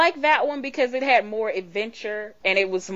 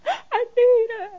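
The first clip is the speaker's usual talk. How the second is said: gasping, much higher, slow whimper; mock desperate plea; 7 dB louder, slower than usual. Craving more Doctor Who, a gasping, hammed-up whine. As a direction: beg for it like a starving fan. Fan begging for more episodes.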